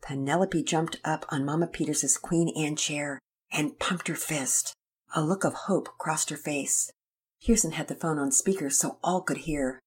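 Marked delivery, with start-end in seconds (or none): none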